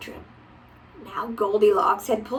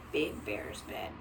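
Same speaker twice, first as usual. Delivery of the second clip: quiet repeat; 13 semitones lower, 12 dB quieter than usual. Real, not acted. Repeating softly, slower.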